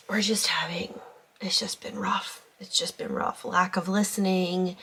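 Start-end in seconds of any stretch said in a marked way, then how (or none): 0.1-3.4 hushed, teary whisper; barely holding it together after tears; after crying. Real, not acted. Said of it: "I have been crying".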